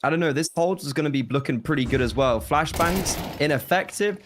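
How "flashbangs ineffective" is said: raised, quick play-by-play call; esports caster. Acted. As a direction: call the round as it unfolds. Caster calls live action.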